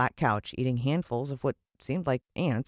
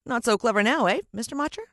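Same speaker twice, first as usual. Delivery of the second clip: put-on much higher, louder boyish gloating; cocky boy taunt; 8 semitones higher, 4 dB louder than usual. The boy mocks fallen Mister Motcher, high and cocky. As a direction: gloat like a triumphant kid.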